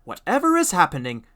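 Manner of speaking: high, loud squealing trucks; screaming trucks. A narrator's reading. Silly trucks bumping into each other, screaming in alarm. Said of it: "they bump into each other screaming".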